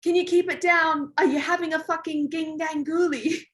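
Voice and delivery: high, put-on voice of the instructor calling up; the instructor yelling up from the rocks, a high put-on voice; imitating Ant. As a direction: mimic the instructor's teasing shout from below. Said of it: "all I heard after that was Ant go".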